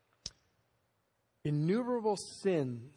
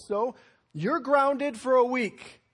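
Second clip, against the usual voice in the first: louder, slow, stern mother; stern mom verdict; 7 semitones higher, 9 dB louder than usual. Retold or imitated. Mom grounding her son for a week, stern and loud. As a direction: lay down the law like a mom.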